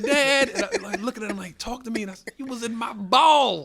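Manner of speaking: high-pitched mock kid voice; imitating his kid. Dad voicing his son's words, high mock child. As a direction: pitch way up like a little boy.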